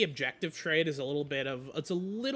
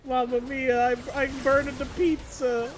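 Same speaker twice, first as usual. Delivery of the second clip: put-on higher, louder Italian accent; jolly Italian pizza chef; 8 semitones higher, 6 dB louder than usual. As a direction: ham up a panicked Italian cook. Caster does a pizza chef bit.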